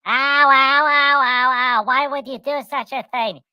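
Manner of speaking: high, loud mock ows; Pudgy wincing. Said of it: "And Pudgy's like".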